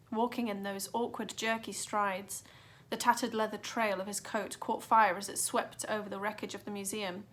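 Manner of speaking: plain reading voice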